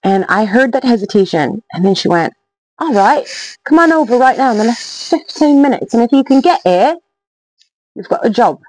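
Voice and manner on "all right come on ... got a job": hammed-up British landlady; bad British accent. Pub lady offering a job by phone, bad British accent. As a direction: do a deliberately rough British accent. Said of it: "sorry for my bad, um, bad accent there".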